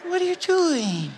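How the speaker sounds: high mortified apology, slow reproach. Retold or imitated. Star apologising to his host and scolding [name], mortified. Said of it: "And [name] was like".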